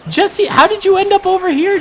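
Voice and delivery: high, loud, incredulous cry; alarmed surprise. Pretend. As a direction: call out to a fallen character in mock alarm. Finds leader fallen in battle.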